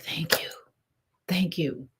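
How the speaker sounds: soft, near-whispered thanks; hushed gratitude. Retold or imitated. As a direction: breathe a quiet thank-you. Retelling her grateful, relieved response.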